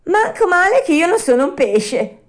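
high, loud relieved puppet voice; a little courage coming back; Pinocchio to himself. A narrator's reading. Pinocchio relieved inside the net, high and loud. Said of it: "disse Pinocchio dentro di sé".

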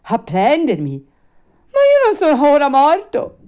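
high, loud, panicky puppet; alarmed protest. Acted. Puppet protests to the rabbits he isn't dead, panicky. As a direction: object in rising fright.

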